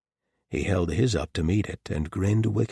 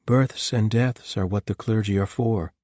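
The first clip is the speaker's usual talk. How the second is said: lightly higher female character voice; Clara's voice; 4 semitones higher, 5 dB louder than usual. A narrator's reading. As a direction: voice Clara, brisk and dry. Narrator voicing Clara.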